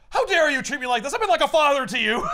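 mock outrage. Jameson scolding Peter for his cheek, hammed-up outrage. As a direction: play the wounded fake father, over the top.

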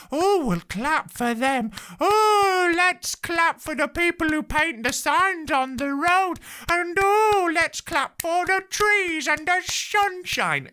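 high, loud, slow gushing cries; mock ecstatic clapping. Comedian parodying cheering crowds, hammed-up high gushing squeal. Mocking over-eager clappers.

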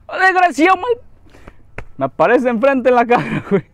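sudden high, loud startled cry; startled shout. Real, not acted. Gamer startled as an enemy pops up in his face. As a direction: jump out of your skin.

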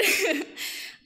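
a short loud laugh through tears; a speaker laughing through her tears at a friend; tearful laugh. Real, not acted.

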